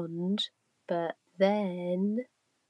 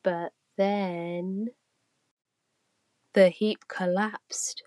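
ordinary delivery, nothing marked

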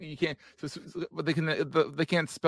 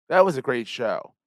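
slightly louder announcer-style promo read; podcast outro read; 4 dB louder than usual. Acted. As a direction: sell the network like a closing plug. Host reads podcast outro promo.

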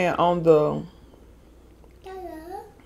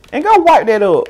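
louder scolding; 4 semitones higher, 6 dB louder than usual. Real, not acted. Scolding child off camera.

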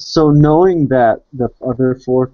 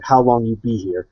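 usual speaking voice

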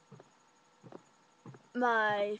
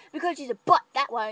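louder sassy jab; sassy retort; 4 semitones higher, 11 dB louder than usual. Retold or imitated. Mackenzie answering the boyfriend for Rachel, loud sassy jab. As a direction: tease on her behalf.

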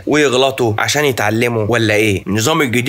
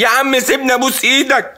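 frantic driver pleading with the beggar, pitched up; desperate pleading; 10 semitones higher than usual. Retold or imitated. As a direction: beg him to let you go. Desperate driver begging to leave.

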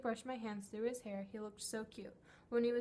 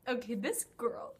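ordinary talk

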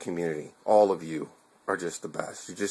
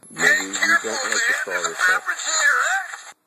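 squeaky crack as the voice gives out; 5 dB louder, much slower than usual. Real, not acted. Offering viewers a drink, his voice cracks high. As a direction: voice breaks way up high, slow. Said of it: "I don't know if you heard that".

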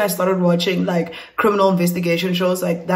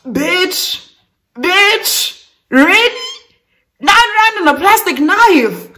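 very high, incredulous squeal; exaggerated high-pitched incredulity; mocking disbelief; 10 semitones higher, 4 dB louder, slower than usual. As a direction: draw each word up high in scornful disbelief. Mocks her robbery in disbelief.

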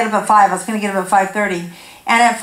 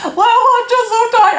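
much higher, put-on weary whine; grumpy whine; 11 semitones higher than usual. Wife answering husband on the drive, grumpy whine. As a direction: complain like a worn-out wife. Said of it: "Why have you been so grumpy".

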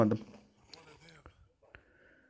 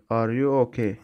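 ordinary talk, nothing marked

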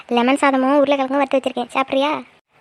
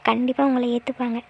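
ordinary talk

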